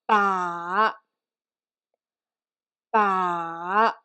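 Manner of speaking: each "Ba" sinks low, then lifts; low V-shaped pitch on "Ba"; third tone. Shown as a demonstration. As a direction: draw out "Ba" downward, quick rise at the end. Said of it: "Let's say that twice".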